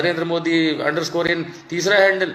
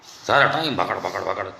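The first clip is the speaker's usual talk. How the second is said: mock chatter noise; 9 semitones lower than usual. Mocking Modi's endless chatter.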